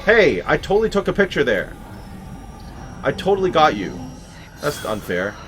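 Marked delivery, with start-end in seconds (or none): 0.0-4.0 loud, higher gloating yell; triumphant shout. Real, not acted. Gamer catching the ghost on film, loud gleeful crowing. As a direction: crow over the lucky shot.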